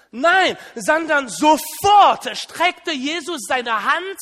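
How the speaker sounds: loud, slow, driving correction; emphatic shout. Real, not acted. Preacher shouts at the congregation to correct the picture. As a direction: shout down the parody with the truth.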